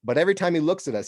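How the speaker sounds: ordinary delivery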